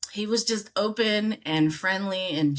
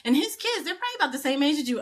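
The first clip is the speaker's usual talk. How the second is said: gossiping coworker; 9 semitones higher than usual. Retold or imitated. Coworker gossiping about his wife.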